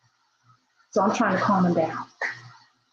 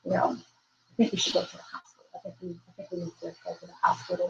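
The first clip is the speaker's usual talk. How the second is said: hushed suggestion; 8 dB quieter, faster than usual. Retold or imitated. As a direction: say it softly so he barely notices. Quietly suggesting hospital, unbeknownst to him.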